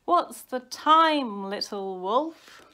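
slow singsong whine; whining. A narrator's reading. Baby wolf pesters his brother, slow whining. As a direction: draw it out like a pestering child. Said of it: "he whined".

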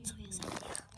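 hushed whisper. Real, not acted. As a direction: whisper the plan secretly. Whispering a plan.

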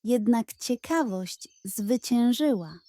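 usual speaking voice

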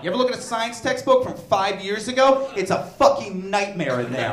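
normal talking voice

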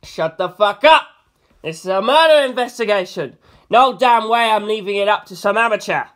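loud furious bellow; angry shout. A narrator's reading. NYPD Blue shouting down his owner, loud and angry. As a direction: tell him off at full volume.

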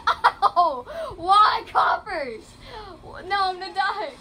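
high, loud yelps with laughter; panicked yelling. Real, not acted. Gamer under attack from cop cars, panicked yelling. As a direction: cry out in excited game panic.

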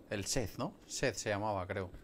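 ordinary talk, nothing marked

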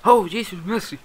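high, loud put-on exclamation; mock-alarmed high voice; mimicked catchphrase. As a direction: mimic a dramatic cry for mercy. Repeats the imitated catchphrase.

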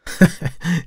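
high, short giggle; light laugh. A narrator's reading. Model laughs off the artist's teasing, giggling. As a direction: giggle before answering. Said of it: "She laughed and shook her head".

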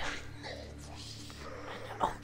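slow hushed whisper. Acted. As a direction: breathe it out slowly. Reading cultist lines in whisper.

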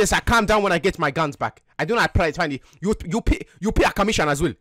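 put-on African accent. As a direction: speak in a mock African accent. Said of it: "so you don't have an African accent".

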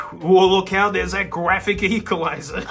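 ordinary talk, nothing marked

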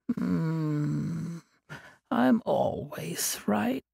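sleepy voice. Acted. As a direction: drawl it out half asleep. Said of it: "Des said with a sleepy voice".

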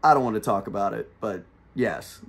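low, dropping to a soft aside; retold sheepish admission. Trooper admitting the mower stop to driver, sheepish and low. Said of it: "kinda breaks out in a smile".

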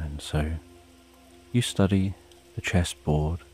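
ordinary delivery

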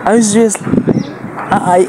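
can't believe what he just heard; surprised. Real, not acted. Vlogger reacting to a stranger's answer, surprised.

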